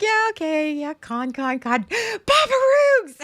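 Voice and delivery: high, excited group voice with a gasp; acted excitement. Fans acting out their eagerness to meet a friend, excited. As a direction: burst into a high, loud cheer on the name.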